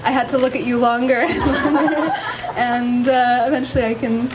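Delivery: nervous laughing vows; shy, giggling through her vows; saying wedding vows. Real, not acted. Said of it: "would you please share your vows".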